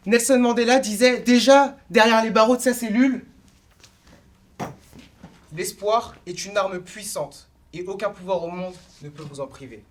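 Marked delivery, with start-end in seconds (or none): 5.6-9.8 solemn quotation. Acted. Orator quotes Nelson Mandela from his cell, solemn quotation. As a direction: let the quote settle quietly, unhurried.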